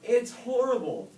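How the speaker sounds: very high, loud horrified cry. A narrator's reading. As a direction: scream it in terror. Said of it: "they cried".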